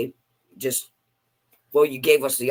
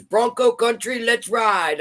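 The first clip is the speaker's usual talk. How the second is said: announcer call; 7 semitones higher, slower than usual. Retold or imitated. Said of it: "she goes".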